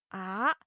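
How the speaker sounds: "Ah" climbing from low pitch; second tone "Ah", swept upward; rising tone. Shown as a demonstration. Teacher shows pitch goes up, rising "Ah". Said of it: "Your pitch goes up".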